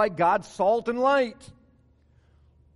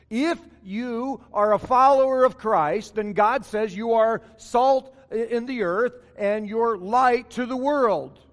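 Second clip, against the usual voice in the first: louder, slow preacher's proclamation; fervent preaching; 3 semitones higher, 6 dB louder, slower than usual. Acted. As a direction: declare it to the whole church. Preaching at full force.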